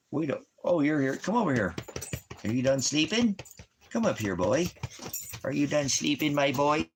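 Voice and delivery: high, sing-song pet voice. Real, not acted. Vlogger greets his sleepy dog in high baby talk. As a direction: coo up at the waking dog.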